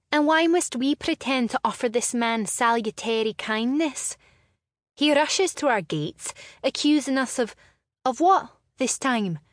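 high, loud, indignant rant; rising outrage at the accusation; indignant protest. Acted. Sister angrily protests to brother.

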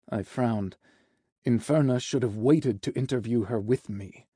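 usual speaking voice

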